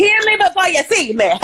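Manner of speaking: loud and high, greeting with a burst; loud entrance. Real, not acted. Guest bursting onto the show, loud. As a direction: announce yourself loudly. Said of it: "Loud and then just there".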